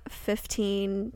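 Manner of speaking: ordinary talk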